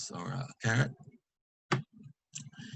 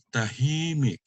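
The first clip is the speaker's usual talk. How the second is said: TAHIMIK with long, raised HI; penultimate stress; 4 semitones higher than usual. Shown as a demonstration. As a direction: stretch HI in TAHIMIK. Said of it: "accent is on the second to the last".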